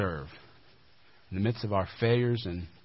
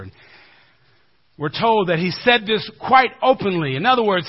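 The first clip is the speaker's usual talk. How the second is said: normal talking voice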